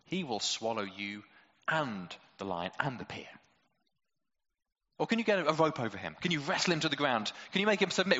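usual speaking voice

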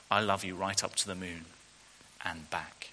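big hare softly answering the little one; whispered with a smile. Acted. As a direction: breathe it out tenderly with a smile. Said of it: "he whispered with a smile".

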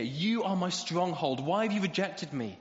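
slow and loud, pressing the question; pleading complaint. Acted. The psalmist confronts God, in a slow, loud, pleading voice. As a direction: cry out to God as if owed an answer. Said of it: "when the psalmist says".

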